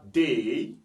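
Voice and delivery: "day" with its "ey" vowel drawn out; English AY sound. Shown as a demonstration. Teacher reading the example word, drawn-out AY. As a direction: stretch the ending of "day". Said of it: "ça donne le son A".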